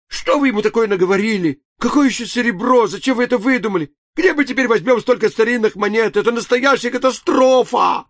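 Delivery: high, loud, rapid panic; panicked chatter. A narrator's reading. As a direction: babble in alarm. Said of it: "затараторили хором".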